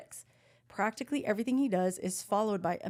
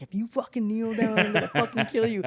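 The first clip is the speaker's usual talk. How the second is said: louder, laughing mock threat; 4 dB louder than usual. To the kneeling boyfriend, laughing mock threat. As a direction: threaten while cracking up. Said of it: "I was like".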